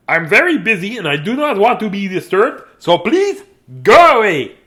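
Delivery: high, loud, building to a shout; exasperated dismissal. A narrator's reading. Dudley shooing his sisters away, exasperated and loud. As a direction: send them packing, loudly.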